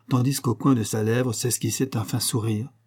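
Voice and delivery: usual speaking voice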